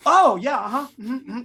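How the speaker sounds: high, overly agreeable yes; eager agreement. Retold or imitated. Actor not arguing with the director, eagerly agreeable. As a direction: agree readily, starting high and easing off. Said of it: "you don't argue".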